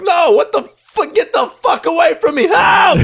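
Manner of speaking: very high, loud shrieking yell; hammed-up panicked shriek; mock screaming victim. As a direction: scream for help in mock terror. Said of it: "They're like".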